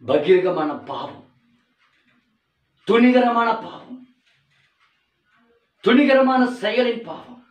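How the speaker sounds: high, loud, slow sermon build; slow fiery preaching. Acted. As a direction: hammer each sin slowly. Preaching at full force. (மெதுவான உரத்த பிரசங்கம்)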